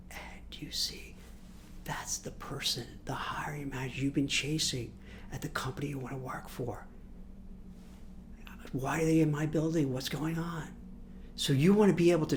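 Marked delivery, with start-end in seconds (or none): 0.1-6.8 hushed aside; whisper. Retold or imitated. Spotting the hiring manager in the lift, a whisper. 8.7-10.7 hushed surprise; whispered thought. Retold or imitated. Seeing the manager walk in, a whispered inner question.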